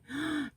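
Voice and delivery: sharp soft intake of breath; mother remembering seeing her son asleep, shocked gasp. Retold or imitated.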